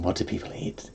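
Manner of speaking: whispered, breathy seduction. Pretend. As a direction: breathe it out like a starlet. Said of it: "Oh, I can do a breathy voice".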